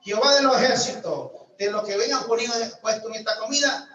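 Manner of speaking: loud prayer. Retold or imitated. Man prays loudly over food he fears is cursed, defiant shout. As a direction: proclaim it over the plate like a challenge to God. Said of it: "Le dije".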